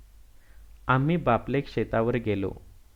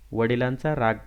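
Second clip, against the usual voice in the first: ordinary delivery, nothing marked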